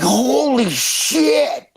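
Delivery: slow, high, drawn-out amazement; mock childlike awe; awestruck. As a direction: gasp it out like a stunned kid. Kids awestruck by Russian swearing.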